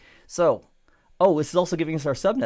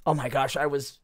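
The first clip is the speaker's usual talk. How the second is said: voiced commenter. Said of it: "again, my voice, not theirs".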